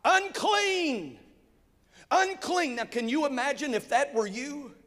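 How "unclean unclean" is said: high, called-out cry of Unclean; shouting a warning. Retold or imitated. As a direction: call it out to the street like a warning. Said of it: "they would begin to shout".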